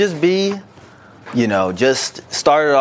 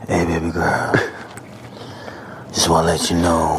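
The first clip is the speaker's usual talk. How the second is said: Romeo voice; 7 semitones lower, 3 dB quieter than usual. Pretend. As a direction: lower the voice and woo her. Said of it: "this is Romeo right here".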